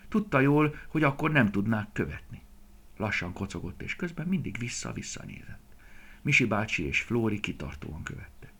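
ordinary delivery, nothing marked

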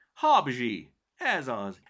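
loud, high eureka; excited naming. Acted. Cirmi naming the kitten, delighted. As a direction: burst out with the new name.

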